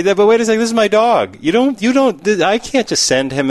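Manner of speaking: high-pitched, flustered objection; acted worried protest. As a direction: protest with hammed-up concern. Owner protests in acted quote.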